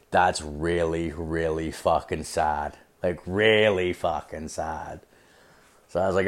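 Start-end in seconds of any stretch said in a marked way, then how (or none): 0.1-5.0 low, slow and hushed; quiet, drawn-out pity; pitying verdict. Retold or imitated. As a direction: drop it low and draw it out in disappointment. Deadpan pitying verdict on her.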